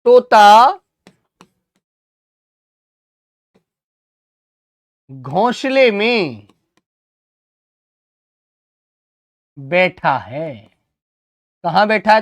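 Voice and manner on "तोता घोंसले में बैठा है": one phrase at a time, very long gaps; slow dictation. Shown as a demonstration. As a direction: dictate it for pupils copying it down. Dictates sentence slowly while writing.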